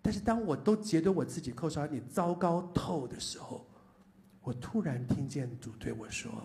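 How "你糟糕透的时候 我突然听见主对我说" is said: ordinary delivery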